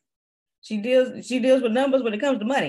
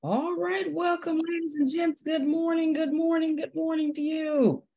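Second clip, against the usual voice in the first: high, sustained sing-out greeting; announcer welcome; 7 semitones higher than usual. Real, not acted. Host welcoming ladies and gents to the show, announcer call. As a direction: ring out the welcome like an emcee, high and drawn through.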